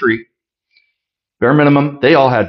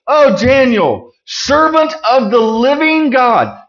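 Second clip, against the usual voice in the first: higher, slow anguished cry calling out to Daniel; 9 semitones higher than usual. Acted. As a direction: call into the pit in dread, drawn out. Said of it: "he cried in a tone of anguish".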